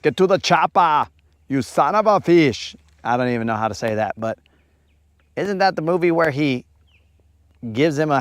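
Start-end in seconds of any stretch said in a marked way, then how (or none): 0.0-2.8 Schwarzenegger impression. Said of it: "and then he goes".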